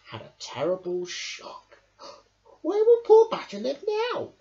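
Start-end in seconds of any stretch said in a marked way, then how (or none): 2.6-4.3 high, loud shocked question; shocked hedgehog voice; gasping Hedgehog. A narrator's reading. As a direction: gasp at the damage. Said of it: "gasped Hedgehog".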